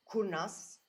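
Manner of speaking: usual speaking voice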